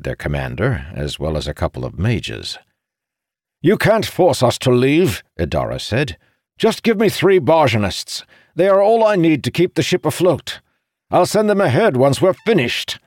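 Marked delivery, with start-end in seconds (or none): 3.6-13.0 high-pitched urgent insistence; desperate protest. A narrator's reading. Ship's owner bargaining with the commander for three workers, desperate protest. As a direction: argue urgently, voice pushed up high. Pleading with commander to keep ship.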